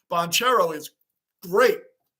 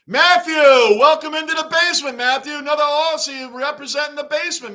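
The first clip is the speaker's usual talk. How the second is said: higher, louder hype greeting; excited welcome; 7 semitones higher, 7 dB louder than usual. Real, not acted. Host welcoming a viewer to the basement, loud and excited. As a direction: boom out the viewer's name like a host.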